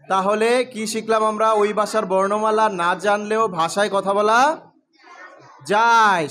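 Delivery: slow and loud, each word spelled out for the class; slow emphatic recap. Shown as a demonstration. Teacher to class, summing up the lesson, slow and emphatic. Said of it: "সবাই বলো".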